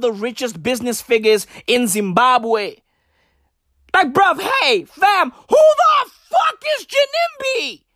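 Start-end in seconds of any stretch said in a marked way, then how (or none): 3.9-7.8 mock-outraged shriek; exasperated shout. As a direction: throw the question at everyone, yelling. Exasperated rant about Ginimbi.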